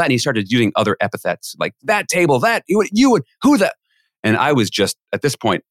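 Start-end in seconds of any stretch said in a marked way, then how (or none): 1.8-3.7 high, sputtering mock outrage in broken phrases; imitated tirade. Guest laying into the MC with epithets, imitated tirade. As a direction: act out a man ranting, pitched high. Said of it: "he's laying into me".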